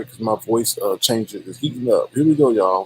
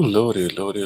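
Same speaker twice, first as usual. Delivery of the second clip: ordinary talk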